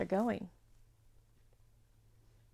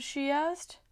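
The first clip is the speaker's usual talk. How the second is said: plain reading voice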